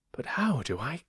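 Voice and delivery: whispered scheming fox; a sly plotting whisper. Acted.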